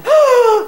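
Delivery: loud, high gasp; sharp high-pitched gasp of amazement; shocked gasp. Retold or imitated. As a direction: gasp in sudden astonished recognition. Retells seeing the memorial on truck.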